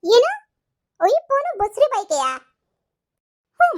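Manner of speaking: high, loud incredulous shriek; shocked outburst. Acted. Pregnant wife shocked that her iPhone craving is refused, shrieking in disbelief. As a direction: explode in disbelief.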